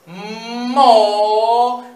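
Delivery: "Moneda" very slow and loud, like a teacher; said very slowly. Shown as a demonstration. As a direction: stretch every syllable of "Moneda". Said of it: "Entonces decimos despacito".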